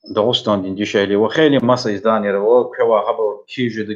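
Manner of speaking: usual speaking voice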